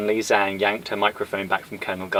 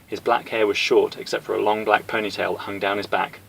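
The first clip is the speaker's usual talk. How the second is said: ordinary delivery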